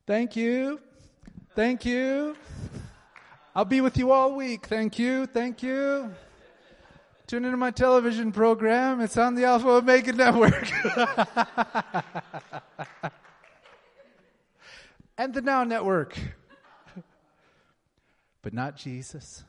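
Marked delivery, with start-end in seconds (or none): 0.1-10.7 much higher, much louder mock celebrity, basking in cheers; vain celebrity. A preacher mocking a vain king riding in to cheers, hammed-up and loud. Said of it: "we would have been like". 10.8-13.1 loud laugh. Real, not acted. Laughing at own impression. 15.2-16.2 a much higher mock plug, laughing; laughing through. A preacher still laughing at his mock celebrity plug. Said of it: "we would have been like".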